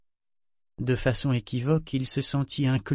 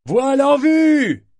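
high, drawn-out shout from the masthead; long, high hail of a sail sighted; lookout's cry; 9 dB louder, slower than usual. A narrator's reading. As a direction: call it out slowly across the ship, much higher and louder. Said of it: "le cri désormais familier".

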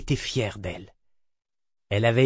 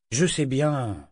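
ordinary delivery, nothing marked

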